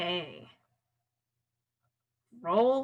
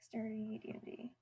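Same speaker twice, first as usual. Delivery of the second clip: hushed whisper to himself; 15 dB quieter, faster than usual. Real, not acted. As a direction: whisper it under your breath. Whispered aside off mic.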